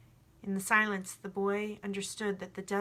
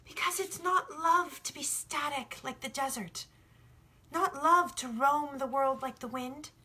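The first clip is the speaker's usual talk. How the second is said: put-on higher, eager young shepherd explaining; young boy, earnest; 6 semitones higher than usual. The boy tells the sun about love, earnest and higher. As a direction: press the point as a sincere boy.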